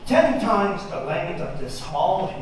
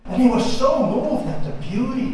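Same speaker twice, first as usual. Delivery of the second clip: plain reading voice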